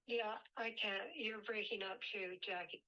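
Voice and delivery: normal talking voice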